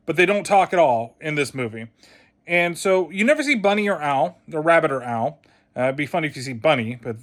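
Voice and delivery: normal talking voice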